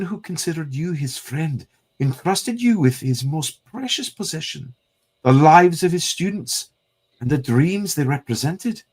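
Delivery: put-on a Scottish accent that accuses an old friend. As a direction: Moira reproaching Magneto in a Scottish accent. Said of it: "the compliment on the Scottish accent".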